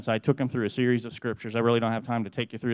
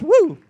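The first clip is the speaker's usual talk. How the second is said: joyful whoop; 13 dB louder than usual. Real, not acted. Celebrating dad's conversion.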